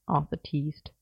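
ordinary delivery, nothing marked

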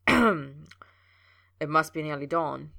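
rough just-woken voice; throat-cleared husky voice. A narrator's reading. Clears throat, awkward morning after.

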